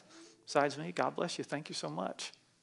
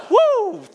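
very high, loud whoop; excited whoop; 12 dB louder than usual. Real, not acted. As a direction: let out a piercing "woo" of joy. Whoop after congregation chant.

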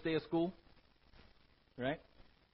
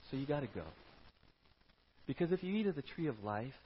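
softer, caring voice; gentle God; 3 semitones lower, 5 dB quieter than usual. Acted. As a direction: explain gently like a loving parent. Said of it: "But it was more".

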